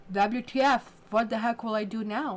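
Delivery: mock indignant complaint; acted exasperation. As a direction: voice someone frustrated at being stuck. Voicing frustrated Aries stuck inside.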